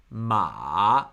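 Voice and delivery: ma dips low then rises; third tone. Shown as a demonstration. Teacher gives ma the third tone, dipping. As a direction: let ma sink low and come back up. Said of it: "give it the four tones".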